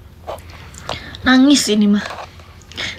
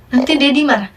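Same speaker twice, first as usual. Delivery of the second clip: ordinary talk, nothing marked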